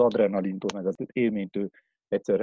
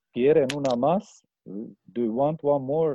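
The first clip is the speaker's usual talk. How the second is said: higher, louder question to a crowd; mock calling out; 5 semitones higher, 3 dB louder than usual. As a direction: call it out to the whole group, lightly acted. Boat crew calling to the group.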